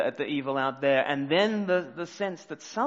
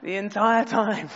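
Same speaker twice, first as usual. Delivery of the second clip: laughing; 8 semitones higher, 5 dB louder than usual. Real, not acted. Laughing at own mistake.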